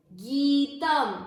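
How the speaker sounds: गीतम with the गी held long, said very slowly; long gee. Shown as a demonstration. Sanskrit teacher to students, गीतम with a long drawn गी. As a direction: stretch the गी in गीतम. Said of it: "बड़ी मात्रा गी की, मतलब इसको उच्चारण में ज्यादा समय देना है".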